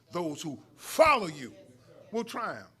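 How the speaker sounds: sudden loud emphasis on "follow"; stress on FOLLOW. Shown as a demonstration. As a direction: hit "follow you" hard, then ease off. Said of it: "Notice I put the emphasis".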